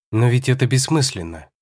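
usual speaking voice

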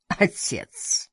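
the word as a breathed-out insult; deadly insult. A narrator's reading. Madame repeats Karla's word, as a deadly insult. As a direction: utter it with understated contempt. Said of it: "будто это было смертельное оскорбление".

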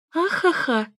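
high ringing acted laugh; ringing woman's laugh. A narrator's reading. Neighbour heard laughing through the wall, high ringing laugh. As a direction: peal out a high ha-ha. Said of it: "Звонкий женский смех".

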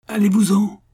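bellowing. A narrator's reading. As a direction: roar him off the rocks. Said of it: "beugla le colosse". (beuglement furieux, bien plus fort)

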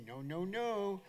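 lightly mimicked small child saying no; imitating grandson. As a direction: copy a toddler's drawn-out refusal. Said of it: "he would look at us and say".

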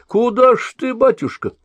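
very high, mock-innocent old woman puzzlement; surprised asking. A narrator's reading. Old woman asks the fleeing sorcerer, mock surprise. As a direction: feign wide-eyed surprise. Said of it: "удивлённо вопрошала".

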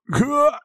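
very high strangled gasp; choked gasp. A narrator's reading. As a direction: gasp as the throat is squeezed. Choked from behind.

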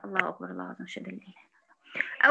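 hushed, low, fading into a whisper; murmured adhan response. Real, not acted. Teacher pauses to echo the adhan, murmured. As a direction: repeat the call to prayer under your breath.

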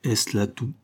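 hollow, booming voice from hiding; a muffled, echoing voice behind the flowers; cavernous voice. A narrator's reading. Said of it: "une voix caverneuse émergea".

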